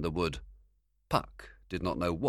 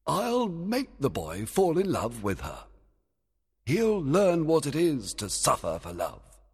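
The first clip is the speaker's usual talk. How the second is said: fairy king voice; 9 semitones higher, 3 dB louder than usual. Acted.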